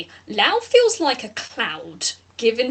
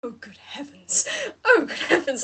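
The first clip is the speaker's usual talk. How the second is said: awed flower; 4 semitones higher than usual. Acted. Flower NPC awed by player.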